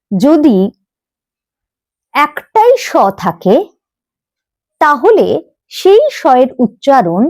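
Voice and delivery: ordinary delivery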